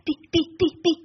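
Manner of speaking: high squeaky mouse peeps; mouse squeak. A narrator's reading. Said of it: "запищал мышонок".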